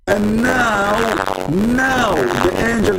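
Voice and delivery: dramatic announcement. Acted. Dramatic build to the angel appearing.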